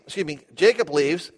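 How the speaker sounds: ordinary talk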